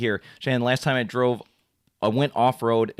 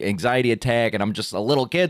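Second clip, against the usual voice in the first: ordinary talk, nothing marked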